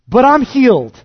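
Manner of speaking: loud, high, jubilant shout; triumphant cry. Acted. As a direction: shout the healing out. Preacher voicing the healed man.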